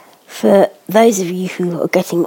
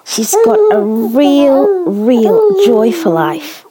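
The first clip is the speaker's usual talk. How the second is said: excited; 9 semitones higher, 5 dB louder, slower than usual. Real, not acted. Gushing about baby's joy.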